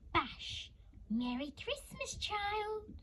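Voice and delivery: gleeful. Acted. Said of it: "exclaim with glee".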